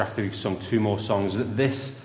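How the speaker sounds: normal talking voice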